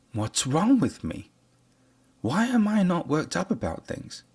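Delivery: high, puzzled, questioning tone; worried listener voice. Retold or imitated. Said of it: "some of you were walking around thinking".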